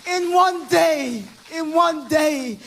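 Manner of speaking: high, loud, slow shouted climax; shouting praise from the pulpit; shouted preaching. Real, not acted. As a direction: build the count to a roar. Preaching at full force climax.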